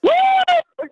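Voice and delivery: high whooping cheer; excited woo. Real, not acted. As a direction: let out a happy whoop. Host celebrating powerful Friday.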